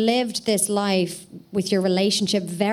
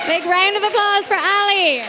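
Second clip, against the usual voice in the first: high, loud crowd call; announcing shout; 9 semitones higher, 5 dB louder than usual. Real, not acted. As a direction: call the winner out to the room. Host announces winner to crowd.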